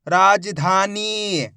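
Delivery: राजधानी very slow, long vowels held; teacher drawing out राजधानी; blended slow reading. Shown as a demonstration. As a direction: join राजधानी slowly, stretching धा and नी. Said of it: "एक ही अक्षर का उच्चारण करके".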